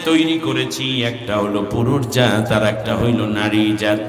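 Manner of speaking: slow, measured preaching; slow sermon explanation. Acted. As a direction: explain it to the crowd slowly. (ধীর লয়ে ওয়াজের ব্যাখ্যা)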